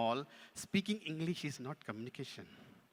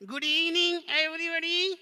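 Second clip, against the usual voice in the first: high, loud puppet voice; puppet greeting; 12 dB louder than usual. Pretend. Puppet greets audience on prompt.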